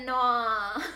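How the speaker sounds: mock Aussie "nah" drawn into "naur"; teasing Australian accent on "nah". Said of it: "typed out N A U R".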